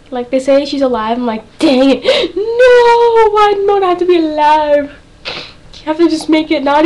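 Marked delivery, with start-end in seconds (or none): 1.6-4.9 loud, high mock wail; hammed-up fangirl despair; dismayed wail. Acting out dismay at show's twist.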